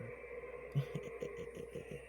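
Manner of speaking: soft, high, breathy cackle; hushed cackle; breathy witch laugh. Retold or imitated. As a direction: exhale a sly witch laugh. Witch laughs after her line.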